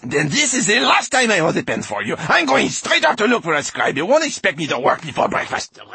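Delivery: tiny, very high, outraged voice; Rosenquartz. A narrator's reading. Glass man threatens to quit, squeaky outrage. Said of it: "said Rosenquartz in his little voice".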